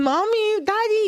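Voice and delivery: put-on a little girl torn between mother and father; little girl calling. Daughter looks between her parents.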